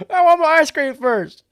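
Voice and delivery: put-on high, whiny childish plea. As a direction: whine like a spoiled kid. Whiny Biden voice.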